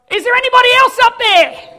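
loud, high hopeful shout upward; calling out. Acted. Man refuses God and calls for another helper, loud shout. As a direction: yell it up the cliff for someone else. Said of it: "then calls out".

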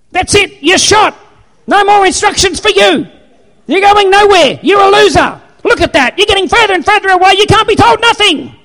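high-pitched, loud mock outburst; furious GPS. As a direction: shriek it like a GPS losing its temper. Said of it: "It doesn't get mad at me".

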